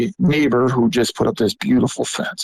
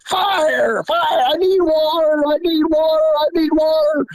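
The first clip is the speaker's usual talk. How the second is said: high, frantic retold yell for water; dad yelling. Dad yelling from the burning lawnmower, frantic high yell. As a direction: shout it high like panicked dad. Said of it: "all I can do is hear my dad yell".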